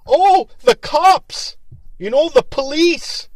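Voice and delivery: high, loud, drawn-out mock dread; mock alarm. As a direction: stretch it out in exaggerated fear of the cops. Mocking others' fear of police.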